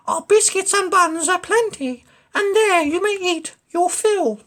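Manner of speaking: high sly duck voice; clever duck. Acted. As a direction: coax the rat like a clever duck.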